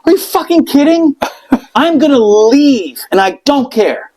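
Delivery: patient in agony snapping at the nurse, exasperated; exasperated outburst. Retold or imitated. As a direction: blow up at the nurse, half laughing. Furious patient retold to nurse.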